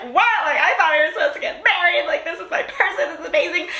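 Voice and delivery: high, giggly heartbroken quote; laughing disbelief. Retold or imitated. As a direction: laugh through the words. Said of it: "You were like".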